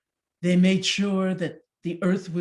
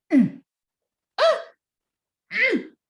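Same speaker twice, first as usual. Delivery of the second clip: high, louder effortful grunts; wife tugging hard; straining grunts; 8 dB louder than usual. Acted. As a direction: heave and strain at the stuck turnip. Pulling the turnip.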